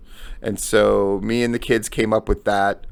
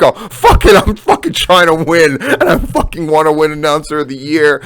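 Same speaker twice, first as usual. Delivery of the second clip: loud, raised voice imitating a nominee; fired-up quote; 5 semitones higher, 5 dB louder than usual. Host imitating a nominee desperate to win, fired up. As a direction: blurt it high and loud, easing slightly by the end.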